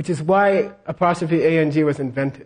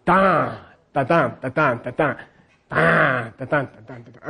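dan strong, da weak, alternating lower; Milarepa meter; 3 semitones lower than usual. Shown as a demonstration. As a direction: swing da-dan like a Milarepa verse. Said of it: "If it's Milarepa".

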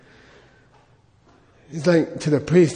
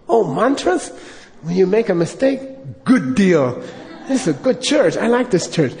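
delighted Lama; 3 semitones higher than usual. Retold or imitated. Lama approving of Hail Marys, delighted. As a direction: praise the church warmly. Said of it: "Lama voiced".

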